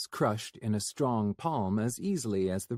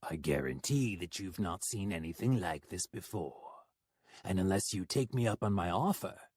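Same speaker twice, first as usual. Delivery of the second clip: honeyed menace; sweetly; 3 semitones lower, 3 dB quieter than usual. Acted. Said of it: "he said sweetly".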